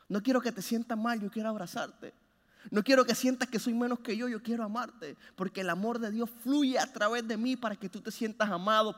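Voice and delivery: ordinary delivery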